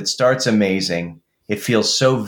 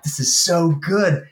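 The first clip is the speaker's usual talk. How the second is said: higher, slow, savouring "This is so good"; mock delight; 7 semitones higher than usual. Gushing to a friend about the song, mock delight. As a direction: gush with a mock relish.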